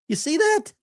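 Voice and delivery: shrill, high squeal of disbelief; squealing surprise. Real, not acted. Streamer shrieks at viewers over the photo, high and incredulous. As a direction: jump way up in pitch, as if you can't believe it.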